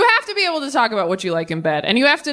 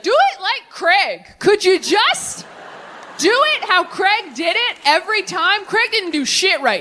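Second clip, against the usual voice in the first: exasperated outburst; 10 semitones higher than usual. Acted. Inner voice demanding the ex's technique, exasperated and high. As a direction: burst out impatiently, pitched way up.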